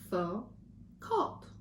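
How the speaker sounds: slow, syllable by syllable; split into syllables. Shown as a demonstration. Teacher counting syllables for the class, slow and chopped. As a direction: chop each syllable out slowly. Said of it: "let's count how many times my jaw drops".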